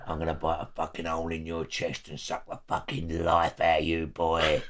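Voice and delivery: a hint of a low monster growl; lightly voiced menacing brute; horror film voice. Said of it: "The voice was out of a fucking horror film".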